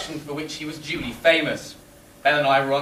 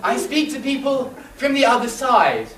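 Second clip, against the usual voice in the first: high, theatrical medium voice; high-voiced séance pronouncement; spiritualist medium; 9 semitones higher, 3 dB louder than usual. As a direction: proclaim it as a mystic, pitched well up. Medium proclaims her powers in story.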